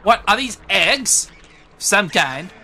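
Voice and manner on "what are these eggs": ordinary talk, nothing marked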